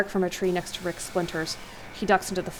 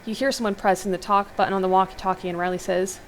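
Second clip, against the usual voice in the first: ordinary talk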